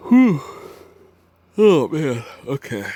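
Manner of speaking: yawning through the words; big stretching yawn. Real, not acted. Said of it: "so how come I'm yawning?".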